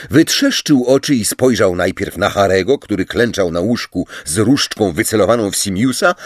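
plain reading voice

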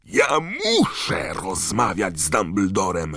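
loud, high, drawn-out yell; Harry roaring. A narrator's reading. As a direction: bellow the demand in desperation. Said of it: "ryknął Harry". (głośny, przeciągły ryk)

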